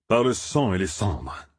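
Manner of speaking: slow, growled curse; growled oath. A narrator's reading. Tom curses as the enemy nears, growling. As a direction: grumble the oath out slowly. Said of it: "grogna Tom".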